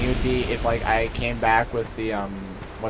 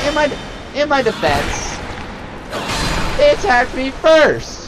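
indignant protest; 11 semitones higher, 3 dB louder, slower than usual. Real, not acted. Defending himself to Rat King.

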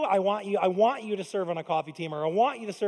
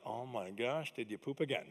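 weary parent; 6 semitones lower, 11 dB quieter than usual. Retold or imitated. Tired parent after many diapers.

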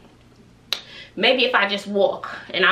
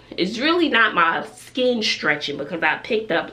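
usual speaking voice

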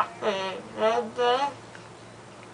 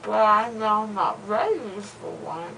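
loopy slurred rambling; drugged, drowsy talk; after wisdom teeth. Real, not acted.

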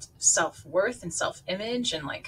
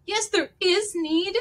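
tearful insistence; 11 semitones higher, 4 dB louder than usual. Acted. Weeping orphan insists.

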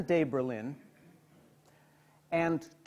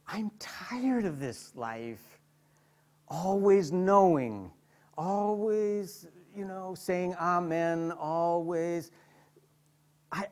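slow, drawn-out world-weary angel; acted weariness, lingering on each phrase; weary longing; 2 semitones higher, slower than usual. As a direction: stretch the words out with yearning. Voices weary angel longing for life.

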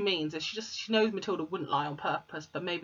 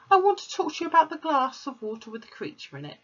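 put-on higher little girl voice, pleading; Matilda; 9 semitones higher, 4 dB louder than usual. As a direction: plead your innocence as a child.

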